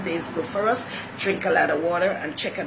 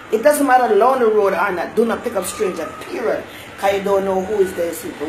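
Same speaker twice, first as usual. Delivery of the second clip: loud, insistent, raised voice; emphatic warning; 3 semitones higher, 7 dB louder than usual. Real, not acted. Warning viewers off picking up strangers, loud and emphatic. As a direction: lay down the rule forcefully.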